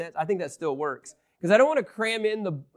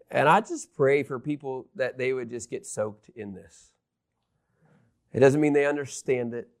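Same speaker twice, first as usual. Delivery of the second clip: closing prayer; 5 semitones lower than usual. Acted. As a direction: pray humbly to close the sermon. Closing prayer over music.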